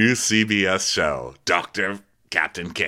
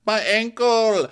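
high, slow "my ankle" whine; mock whimper; 14 semitones higher, 4 dB louder, slower than usual. Host voicing Nathan hurt on the stairs. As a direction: whimper like an injured crew member.

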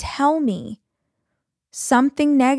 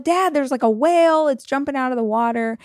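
excited about a whale; 5 semitones higher than usual. Retold or imitated. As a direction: call out to dad, thrilled. Telling dad about a whale.

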